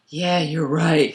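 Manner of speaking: slow, low mock agreement; drawn-out, laughing send-up of meek agreement; Jamie. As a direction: drop low for a doubtful husband conceding. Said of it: "Jamie's gonna go".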